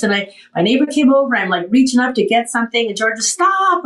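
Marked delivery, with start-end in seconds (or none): 3.2-3.8 high-pitched mock command; imitated scolding. Friend catches patient reaching up, high mock scolding. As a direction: imitate a friend cutting you off.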